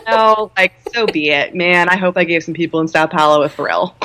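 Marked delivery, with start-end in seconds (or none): none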